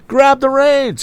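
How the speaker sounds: high, loud urgent cry. A narrator's reading. Tom crying out to the woman, high urgent cry. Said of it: "he cried again".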